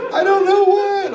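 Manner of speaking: high, loud crying imitation; put-on sobbing child voice; mock tearful wail. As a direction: bawl it like a helpless kid. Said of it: "And, like, tears".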